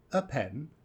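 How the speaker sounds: "a" reduced to a quick schwa before pen; weak form a. Shown as a demonstration. Teacher showing students the weak article. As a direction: say "a" as a light uh, straight into pen. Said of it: "notice we say".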